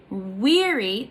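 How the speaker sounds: "weary" with a strong WEA and a light ending; stressed, then unstressed. Shown as a demonstration. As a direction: punch the first syllable of "weary". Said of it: "that is stressed".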